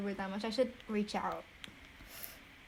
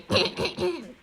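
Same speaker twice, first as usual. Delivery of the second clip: raised throat-clearing splutters; imitated choking; 6 semitones higher than usual. Student answering friend while holding water in, mock choking.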